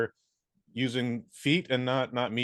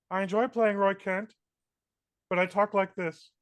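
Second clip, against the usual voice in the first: high, thin puppet voice; high squeaky muppet voice; Kermit-like voice; 9 semitones higher than usual. Retold or imitated. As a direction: talk like a timid frog puppet. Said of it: "talking like Kermit the Frog".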